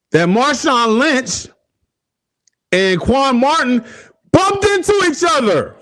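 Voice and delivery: mock him like gloating rivals; mocking football guys. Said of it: "They said".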